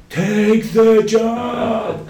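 high, drawn-out mock bellow; huge stretched-out holler; shouting in capitals. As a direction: yell it slowly like an all-caps email. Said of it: "in big letters".

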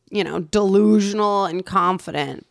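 normal talking voice

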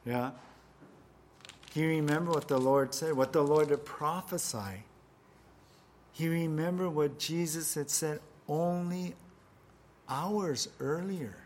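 usual speaking voice

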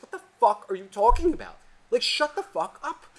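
high, loud mock rant; retold rant at a party guest, pitched high; exasperated outburst. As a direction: blurt it out high and fed up.

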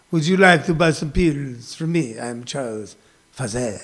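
put-on a hushed French accent peddling pills; mock French accent. Playing Charles Pfizer selling pills, French accent. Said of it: "See, it sounds French".

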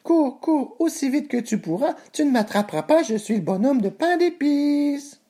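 very high, squeaky sing-song voice; taunting gingerbread man. Acted. Gingerbread man taunts his pursuers, high gleeful chant. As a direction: tease in a tiny high voice, stretching the ending.

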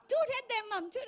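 put-on high, loud, eager child's plea; kids urging. Children urging their mom while she drives, high and loud. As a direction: egg Mom on like an impatient kid.

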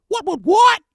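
high, loud astonished what what what; mock disbelief. Host feigning shock at rates in the fives, squealing. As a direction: act stunned by the rate, way up high.

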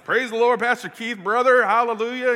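high, loud Southern revival shout; Southern accent. Retold or imitated. Visiting preacher hails the pastor, in an exuberant Southern accent. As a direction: burst into Southern hallelujahs. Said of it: "I just went Southern all of a sudden".

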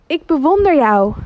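loud, high enthusiastic shout; enthusiastic call. Acted. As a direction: call out with a big breath to the mountains. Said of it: "hij roept naar de bergen". (vader roept enthousiast en luid naar de bergen)

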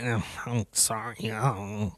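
imitating the old woman caught on her phone, low and meek; mocking the old woman. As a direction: mumble a sheepish sorry, low and small.

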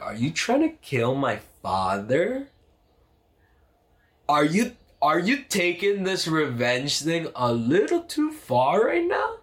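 rising to a high, stretched accusation; half-joking disbelief building up; outraged. Retold or imitated. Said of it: "And I'm like".

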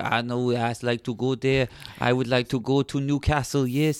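put-on careful, halting foreign-accented English; Santiago's accent. Mexican gardener answers an English scout, polite foreign accent.

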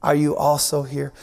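low, disappointed reproach; lightly exasperated teacher; Jesus scolding disciples, mildly exasperated; frustrated Jesus. Retold or imitated. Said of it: "it doesn't sound very positive".

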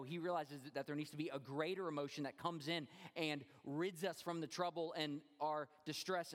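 usual speaking voice

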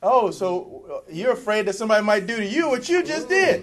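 loud, high mock challenge to Cain; mock incredulous. Mock confronting Cain over his fear.

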